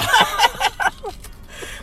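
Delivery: squealing laugh after choking; high burst of laughter. Real, not acted. Choked on snack, laughing.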